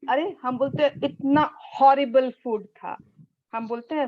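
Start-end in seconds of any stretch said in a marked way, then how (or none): 1.0-3.0 slow and louder, punching "horrible"; a slow, louder "इतना horrible food था"; with force. Shown as a demonstration. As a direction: sell the disgust on "horrible". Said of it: "हम उसमें कितनी जोर लगाते हैं".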